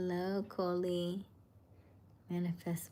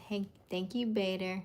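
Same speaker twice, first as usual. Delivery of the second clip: ordinary talk, nothing marked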